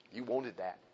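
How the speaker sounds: low, soft accusation; insinuating enemy. Retold or imitated. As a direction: plant the accusation quietly. Enemy's sly accusation voiced.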